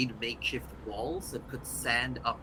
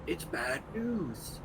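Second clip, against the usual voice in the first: scolding creature voice; 6 semitones higher than usual. Acted. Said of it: "in Abyssal goes".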